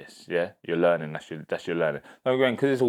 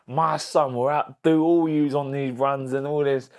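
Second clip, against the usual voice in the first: higher, slow army drill instructor shout; drill instructor bark; 6 semitones higher, 3 dB louder, slower than usual. Retold or imitated. Army instructor father shouting at the Marines, drill bark. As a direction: bark it like a PTI at recruits. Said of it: "He went".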